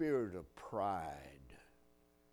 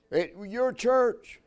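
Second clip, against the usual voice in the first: a real surprised question, slowly; 10 semitones higher, 10 dB louder, slower than usual. Real, not acted. Preacher surprised the trash can was at their church, asking back slowly. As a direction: caught off guard, asking back.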